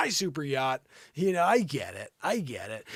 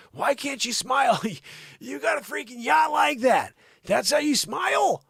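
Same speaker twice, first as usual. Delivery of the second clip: normal talking voice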